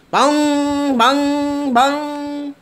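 high, loud sung boing of a bell; playful vocal bell chime; bell ringing. Acted. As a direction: ring out like a church bell. Bell rings again.

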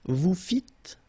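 "Vous fîtes" as vou fit, no S; silent final S. Shown as a demonstration. Teacher modelling a verb form, silent final S. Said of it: "don't pronounce S".

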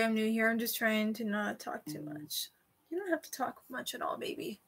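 ordinary delivery

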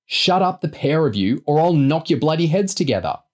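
high-pitched, exasperated telling-off; scolding threat. A narrator's reading. Older kid threatening a squabbling pair, scolding. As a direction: scold them both like a fed-up parent.

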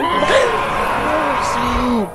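slow, high scared shout; startled gasp. Real, not acted. Gamer scared by a sudden monster. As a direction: get jolted mid-sentence. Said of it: "that scared the fuck out of me".

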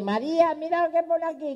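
put-on high old grandpa voice calling out; squeaky old husband excitedly calling María; old man calling wife. Grandpa reads the paper to his wife, high-pitched.

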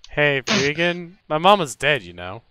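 usual speaking voice

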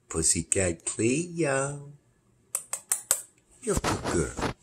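slow, soft drawn-out coo; gentle slow cat coo. Real, not acted. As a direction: stretch each word out to soothe her. Cooing slowly at cat.

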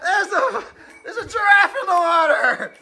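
surprised shout. Real, not acted. Giraffe thrown into race.

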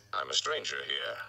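put-on low old storyteller voice; gruff old gleeman. A narrator's reading.

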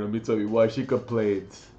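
ordinary talk, nothing marked

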